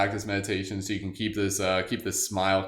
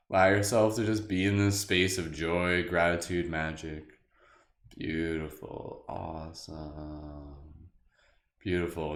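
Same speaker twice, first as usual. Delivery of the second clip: guided meditation; 4 semitones lower than usual. Acted. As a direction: ease them back gently and slowly.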